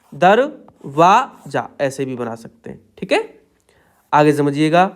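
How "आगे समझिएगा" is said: ordinary talk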